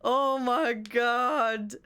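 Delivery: very slow, drawn-out exasperation; long, stretched groan of words; exasperated. Real, not acted. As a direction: drag every word out in disbelief. Exasperated after reliving the incident.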